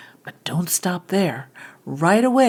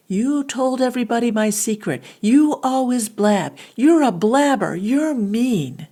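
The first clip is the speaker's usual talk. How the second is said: higher and louder, accusing and hurt; angry friend; 6 semitones higher, 6 dB louder than usual. Acted. Friend yelling at the one who spilled the secret, angry. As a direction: fling the blame at a friend who blabbed. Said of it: "this time with an angry friend".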